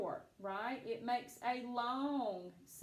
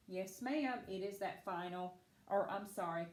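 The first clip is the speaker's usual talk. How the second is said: ordinary delivery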